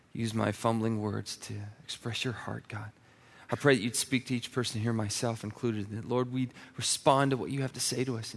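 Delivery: quiet prayer. Acted. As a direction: pray gently over the congregation.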